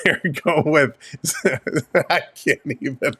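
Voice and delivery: words dissolving into real laughter; losing it laughing. Real, not acted. Host failing to read the lineup, helpless with laughter. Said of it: "I've already lost it".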